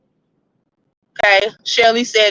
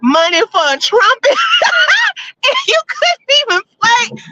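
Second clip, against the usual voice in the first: laughing mom quote; 8 semitones higher than usual. Retold or imitated. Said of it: "She said".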